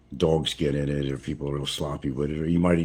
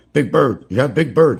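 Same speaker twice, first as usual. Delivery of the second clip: much higher and louder broken English in a put-on local accent; 9 semitones higher, 10 dB louder than usual. Asking the shopkeeper for a grown chicken in a loud, high put-on local accent. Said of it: "gotta put that accent on".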